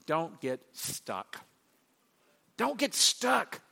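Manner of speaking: tender slow counsel; gentle urging. Retold or imitated. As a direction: coax them gently, slowly. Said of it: "He's going".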